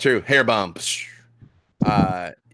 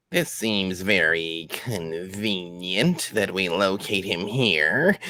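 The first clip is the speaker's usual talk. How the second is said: slow, drawn-out creature voice; suspicious goblin; slower than usual. Acted. Goblin doubts the newcomer, slow and suspicious. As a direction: draw the words out with suspicion.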